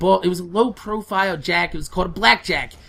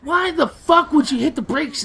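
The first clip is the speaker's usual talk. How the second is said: higher-pitched exasperated demand; re-enacted exasperation, pitched up; retold yelling; 8 semitones higher than usual. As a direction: demand an answer, imitating an upset outburst. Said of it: "I did yell at her a little bit".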